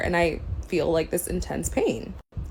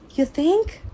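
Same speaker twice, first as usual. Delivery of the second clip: "You think?" pitched way up, mocking; sarcastic; 11 semitones higher, 4 dB louder than usual. Sarcastic rising jab at the careless doctor.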